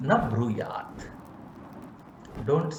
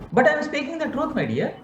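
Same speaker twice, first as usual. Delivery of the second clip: mock self-justifying; 10 semitones higher, faster than usual. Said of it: "That in two weeks you are going to die".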